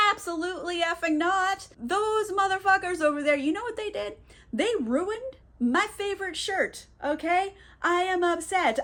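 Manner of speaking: joking fury over a ruined shirt; mock fury. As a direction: play his rage as comic overkill. [name] furious about ruined shirt.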